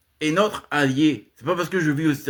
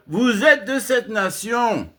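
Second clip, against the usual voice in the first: emphatic shout; 7 semitones higher, slower than usual. Real, not acted. Proclaiming to viewers that they belong, emphatic shout. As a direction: hammer home each word like a verdict. Driving home his point on nationality.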